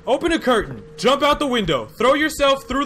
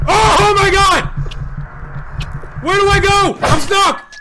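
high, loud panic; panicked; 8 semitones higher, 5 dB louder than usual. Real, not acted. Gamer fleeing the monster, panicked shouting. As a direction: yell in terror while being chased.

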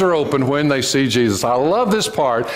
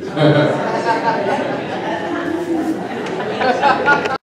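a long, high burst of real laughter; big high laugh; 11 semitones higher than usual. Real, not acted. Preacher cracking up at his own line, laughing.

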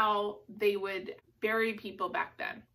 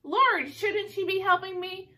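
higher, louder complaint as an irritated sister; annoyed Martha; 8 semitones higher, 4 dB louder than usual. Retold or imitated. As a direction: whine it like the sister left doing all the work. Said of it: "Martha gets mad at her sister and says".

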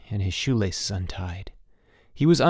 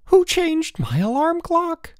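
very high, drawn-out outraged scream; 4 dB louder, slower than usual. A narrator's reading. Elmer to his roommate about the alarm, screaming. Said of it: "screamed Elmer".

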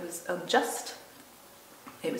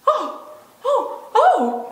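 high, loud wailed ohs; acted girls crying out in dismay; anguished cry; 12 dB louder, much slower than usual. A narrator's reading. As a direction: wail it as if stricken. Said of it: "cried the two girls".

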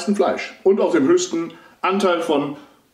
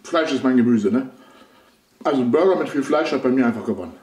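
speaking between bites of burger; chewing while talking; 4 semitones lower, faster than usual. Real, not acted. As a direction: mouth partly full of food. Seen 4: Bites burger at -3.0, jaw working while talking, bites again 3.8.

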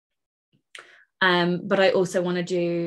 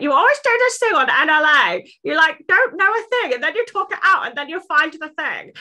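high, loud caricature of a friend; mock a friend in a loud, high-pitched voice; exaggerated high-pitched mimicry; Helen impression; 11 semitones higher, 6 dB louder than usual. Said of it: "Was that an impression?".

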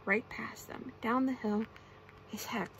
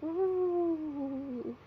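long, high "ooh" sliding slowly down; ghostly wail; 6 semitones higher than usual. A narrator's reading. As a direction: wail like a cold little ghost. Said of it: "it glowed and cried".

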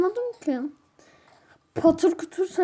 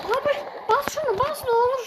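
rushed high plea; frantic begging; 11 semitones higher, faster than usual. Real, not acted. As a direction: beg the button to work, fast and high. Tuşa basması için yalvarıyor. (hızlı, tiz yalvarış)

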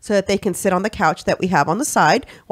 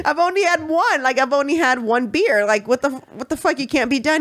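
higher, indignant mock husband; husband who has only had one beer, higher and indignant; protesting husband; 7 semitones higher than usual. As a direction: play the husband who won't leave his beer. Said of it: "He was like".

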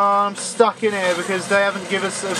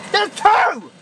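alarmed exclaim; 12 semitones higher than usual. Real, not acted. Spotting a second monster.

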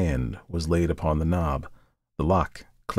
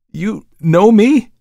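high, loud, drawn-out question; startled cry; 13 semitones higher, 11 dB louder, slower than usual. A narrator's reading. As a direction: cry out in alarmed disbelief. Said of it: "cried the murderer".

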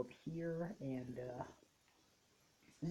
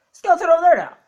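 loud, high-pitched cry of alarm; 8 semitones higher, 14 dB louder, faster than usual. Real, not acted. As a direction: call out a sudden threat. Alarm at sighting a skeleton.

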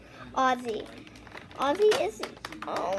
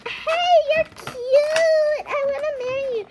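squeaky, much higher, rushed crush voice; mock lovestruck voice; 12 semitones higher, 5 dB louder than usual. Child mocking a crush, high squeaky lovestruck gush. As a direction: gush it like a smitten admirer. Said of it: "But not like, like".

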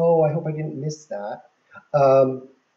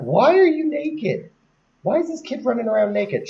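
shocked; 9 semitones higher than usual. Real, not acted. Scolding a naked toddler sim.

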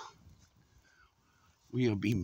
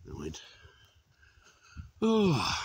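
normal talking voice

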